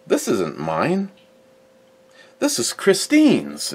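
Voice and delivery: slow, drawn-out mock reveal with a pause, ending high; teasing reveal. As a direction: draw it out like springing a surprise. Retells Ed's slow surprise reveal.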